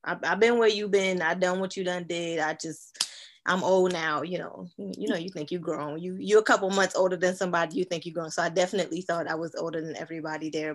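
usual speaking voice

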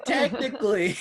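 high, laughing teasing voice. Real, not acted. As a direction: tease the rules lawyer, laughing. Teasing loophole argument.

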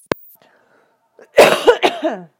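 loud drawn-out hacking cough; long, harsh coughing bout; coughing fit. Real, not acted. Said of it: "I gotta cough".

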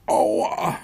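high pained little cry; whiny ouch. A narrator's reading. Drop lands hard on a stone and cries out high. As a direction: wail at the crash landing. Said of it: "jammerte er".

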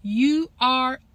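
very slow, emphatic affirmation; booming motivational speech. Shown as a demonstration. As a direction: proclaim it to yourself. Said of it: "Now put your name in there".

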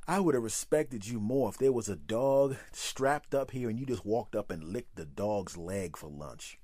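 ordinary delivery

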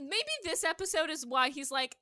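usual speaking voice

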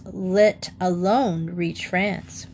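usual speaking voice

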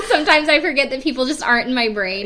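laughing through the line. Real, not acted. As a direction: giggle as you admit it. Laughing self-mockery.

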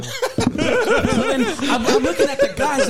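high laughing telling. Real, not acted. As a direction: squeal through laughter.

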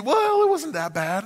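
a hint of a whiny Israelite; mock excuse, high.

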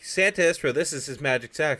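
high, loud declaration; imitated insistent protest. Harmony defending Santa at the train station, insistent. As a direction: protest like an earnest believer.

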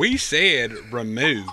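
bad Southern accent. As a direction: copy the Alabama drawl, clumsily. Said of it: "I'm terrible at it".

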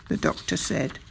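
usual speaking voice

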